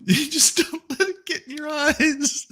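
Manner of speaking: very high squeal through laughter; helpless laughter. Real, not acted. Joking over the cicada clip, laughing helplessly. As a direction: choke the words out while laughing.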